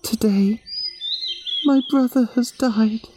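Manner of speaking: put-on a little girl heartbroken over her brother; Yami grieving. A grieving girl answering the animals, high and sad. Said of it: "Yami told them".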